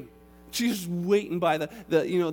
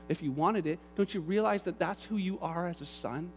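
ordinary talk

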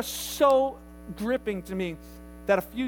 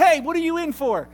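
loud, high call across a room; hammed-up yell at strangers; imitated yelling; 7 semitones higher, 9 dB louder than usual. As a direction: holler it like a nosy patient. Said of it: "she starts yelling at them".

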